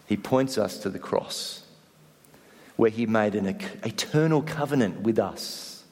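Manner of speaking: usual speaking voice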